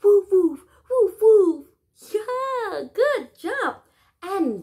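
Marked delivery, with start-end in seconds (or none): 0.0-1.7 loud barking woofs like a dog; loud playful woofs for the kids. Shown as a demonstration. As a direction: bark it out like a big dog. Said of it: "that's how the dog sounds".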